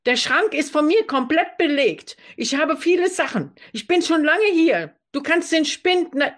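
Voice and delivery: high, entitled lecturing voice; bossy roommate. Acted. Roommate claiming the whole wardrobe, high and bossy.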